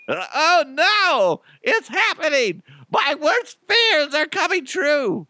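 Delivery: very high squealed mock terror; squeaky, creepy-clown-like panic; hammed-up panic. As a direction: wail in cartoonish horror, way up high. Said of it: "I almost sounded like a creepy clown".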